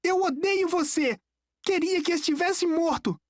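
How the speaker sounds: put-on high, strained boy's fury; acted hateful outburst, pitched high; shouted hatred. A narrator's reading. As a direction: lash out bitterly in a high voice. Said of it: "gritou ele".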